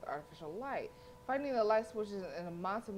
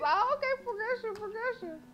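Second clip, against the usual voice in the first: excited; 13 semitones higher, 4 dB louder than usual. Real, not acted. Excited teasing, loud high.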